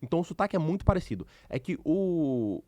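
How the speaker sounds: normal talking voice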